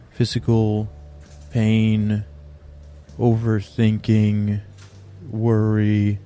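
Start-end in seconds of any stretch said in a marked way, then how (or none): none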